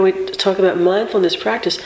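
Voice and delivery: ordinary delivery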